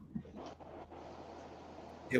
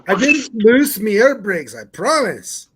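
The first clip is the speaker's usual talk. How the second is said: put-on Canadian accent; 8 semitones higher, 10 dB louder than usual. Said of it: "refining my Canadian accent".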